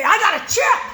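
high, loud and thrilled; proud gleeful shout; excited. Retold or imitated. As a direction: burst out like a kid showing off a prize. Running up proud to him.

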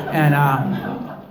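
normal talking voice

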